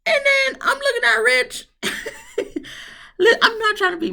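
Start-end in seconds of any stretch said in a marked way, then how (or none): 0.1-1.7 high, giggling through the words; can't hold back laughter; squeaky laughing voice. Real, not acted. Laughing while recalling Rich. 1.8-2.9 sharp high-pitched giggle; high shrieking laugh; high squealing laugh. Real, not acted. Laughs at Rich.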